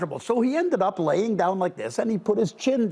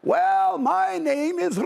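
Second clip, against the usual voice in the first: much higher, slow squeaky gnome elder; little gnome voice; 13 semitones higher, slower than usual. Acted. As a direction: pitch it way up like a tiny old gnome asking who's there. Gnome elder starts to answer.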